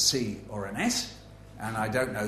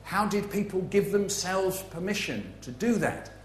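musing thought; 6 semitones higher than usual. Retold or imitated. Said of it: "musing about that and thinking".

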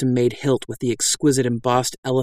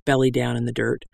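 ordinary delivery

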